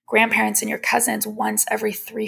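ordinary talk, nothing marked

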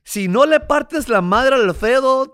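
a son imitates his father roaring a threat at him; threatening father shout. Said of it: "Me dijo".

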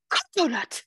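high-pitched "Coconut", KOH-koh-nut; quoted "Coconut" sliding down from high; imitated odd pronunciation. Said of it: "That's not how you say it".

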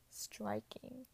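breathy swooning whisper. Real, not acted. Fangirling over the actor, whispered. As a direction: whisper it like you're starstruck.

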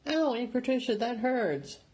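put-on high, complaining young voice; whiny boy. A narrator's reading. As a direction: whine about the sting. Boy complains while wound cleaned.

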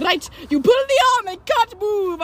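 high, slow instructing boss; mock the boss's martial arts lesson; boss teaching. Boss demonstrating a chokehold, mock instructor. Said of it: "He's like".

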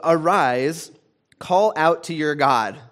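loud, slow, urgent command; captain's urgent command. Acted. Captain urging Jonah to pray, loud and slow. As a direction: order him up, loud and slow.

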